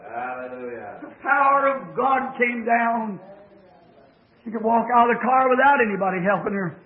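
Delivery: ordinary talk, nothing marked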